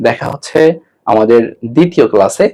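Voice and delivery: ordinary talk, nothing marked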